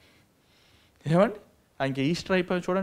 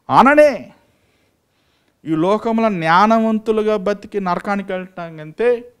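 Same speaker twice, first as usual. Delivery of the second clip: rising, louder sermon build; preaching at full force; 5 semitones higher, 6 dB louder than usual. Acted. Preacher rouses his congregation, fervent and loud. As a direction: drive it up to the climax.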